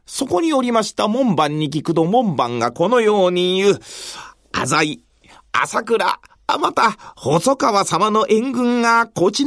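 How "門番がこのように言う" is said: usual speaking voice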